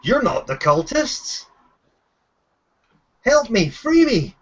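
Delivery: high-pitched call for help, no real volume; restrained shout of a captive begging rescue; prisoner's mock shout. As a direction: plead from a cell, pitch jumping up. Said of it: "he shouts up from his cell saying".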